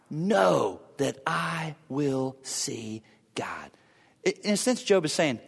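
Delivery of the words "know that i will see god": very slow, softened near-whisper; hushed awe. Acted. Preacher quotes Job's hope of seeing God, in hushed awe. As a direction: let each word fall softly and slowly.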